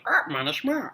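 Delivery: high squawky parrot; high parrot squawk. Acted. Parrot speaks.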